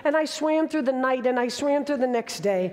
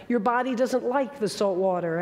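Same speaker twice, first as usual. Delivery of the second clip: normal talking voice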